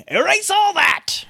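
high, slow playful shout to start over; mock shout. Real, not acted. Host scrapping a botched take with a playful shout. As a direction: call it out to wipe the slate. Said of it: "I'm gonna scrap this whole thing".

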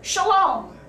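Yiddish-accented Shalom, said brightly. Shown as a demonstration. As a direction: greet them with a Yiddish accent. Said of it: "with a Yiddish accent".

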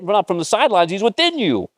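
high, excited preacher in full flow; fired-up preaching. Real, not acted. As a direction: cheer the congregation on, voice pitched high. Preacher still worked up.

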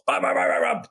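rapid rotor chop; quick, pulsing mouth-made rotor sound; helicopter noise. Retold or imitated. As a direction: imitate a helicopter lifting off. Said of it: "The helicopter just goes".

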